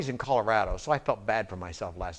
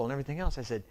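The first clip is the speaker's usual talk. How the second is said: ordinary delivery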